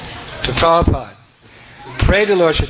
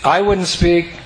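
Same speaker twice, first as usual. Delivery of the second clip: ordinary delivery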